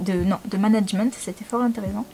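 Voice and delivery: ordinary talk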